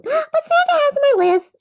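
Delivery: put-on squeaky little girl voice; sing-song child bragging; hammed-up little girl; high child voice.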